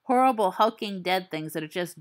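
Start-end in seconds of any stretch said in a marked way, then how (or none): none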